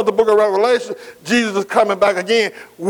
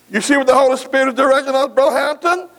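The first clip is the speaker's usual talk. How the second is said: usual speaking voice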